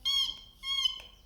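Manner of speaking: very high squeaky eek; tiny squeaking hamster. Acted. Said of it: "Even the hamster said".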